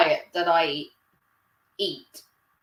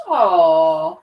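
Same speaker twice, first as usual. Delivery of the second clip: loud aww; 4 semitones lower, 9 dB louder than usual. Real, not acted. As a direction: coo loudly at something sweet. Hearing the resident was worried.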